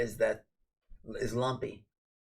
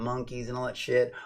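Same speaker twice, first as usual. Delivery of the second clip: ordinary talk, nothing marked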